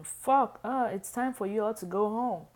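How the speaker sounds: loud, high-pitched acted scream; nana's scream. A narrator's reading. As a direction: holler it out as a scolding nana. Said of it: "I heard my nana scream".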